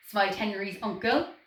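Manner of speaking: ordinary delivery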